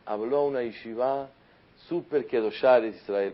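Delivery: normal talking voice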